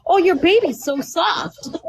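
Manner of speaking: put-on high, slow, syrupy coo at the baby; pointed mock-gushing over a baby; mock sweet cooing. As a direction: coo it back at her in mock admiration. Mockingly petting a stranger's baby.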